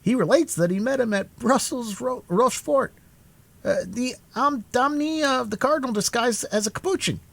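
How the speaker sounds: a younger musketeer, pitched well up; lightly excited musketeer telling news; high musketeer voice. A narrator's reading.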